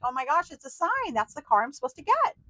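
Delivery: mock giddy car shopper; excited realization. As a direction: gush like you just spotted a sign. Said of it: "And you're like".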